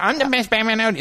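high goofy braggart; impression of Marty. Rival Batman bragging all day long, high goofy impression. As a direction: squeak out the boast in a silly voice. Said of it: "He would literally, like".

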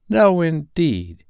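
put-on high, slow agreeing old woman; drawn-out prim laundress; laundress's voice. A narrator's reading. Dilber voiced.

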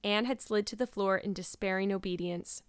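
ordinary delivery

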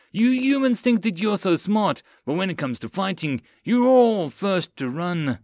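high, scornful tirade; screamed accusation. Acted. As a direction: rant in contempt, stretching the end. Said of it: "Karath screamed at Mills".

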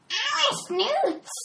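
very high, slow squawking bird voice; shrill screeching bird woman. Acted.

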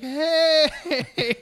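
long, high, loud mock hello; awkward group greeting. Friends greeting the newcomer, awkward group greeting. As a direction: stretch it out high like an overdone welcome.

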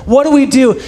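high, loud mock panic; acted freaking out. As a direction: play frantic disciples at a loss. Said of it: "they're down there freaking out".

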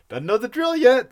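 "Doesn't know the drill yet." lifted high and tickled. Retold or imitated. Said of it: "really liked that line".

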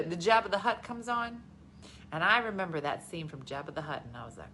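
ordinary talk

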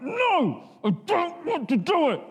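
put-on high, far-off childish whine; mock petulant no; whiny refusal. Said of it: "And I'm like".

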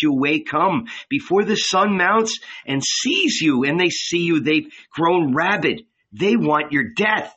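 a lightly voiced woman warning her beloved; Magdalene, urgent.

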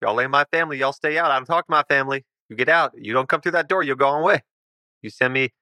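high, hurried, defensive homeowner; hostile recluse. Acted. Homeowner refuses to see the visitors, defensive. Game master plays Carl.

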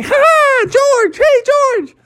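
very high gleeful calls; excited kids calling. Acted. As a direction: cheer after George like giddy kids. Kids flying out window calling.

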